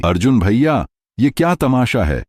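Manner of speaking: high-pitched indignant protest; angry outcry. A narrator's reading. Payal confronting Arjun over wasted money, angry and high-pitched. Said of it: "उसने गुस्से से चिल्लाते हुए".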